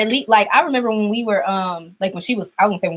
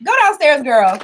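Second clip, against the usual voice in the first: shooing; 6 semitones higher, 5 dB louder than usual. Real, not acted. Shooing daughter away.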